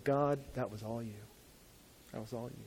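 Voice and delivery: soft awed prayer; hushed prayer. Pretend. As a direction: whisper it to God in awe. Prayer of thanks on knees.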